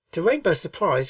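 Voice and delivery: ordinary delivery, nothing marked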